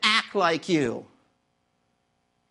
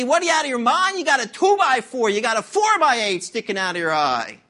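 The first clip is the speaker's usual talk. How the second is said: loud, high acted indignation; mock incredulous outburst; 4 semitones higher, 4 dB louder than usual. Neighbour to beam-eyed helper, loudly incredulous. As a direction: protest loudly as if he's crazy.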